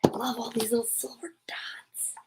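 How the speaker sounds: soft, admiring whisper. Real, not acted. Admiring the silver dots on the page, whispering. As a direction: hush it while gazing at the page. Awed aside while reading.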